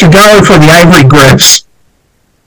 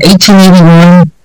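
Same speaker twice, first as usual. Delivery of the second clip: usual speaking voice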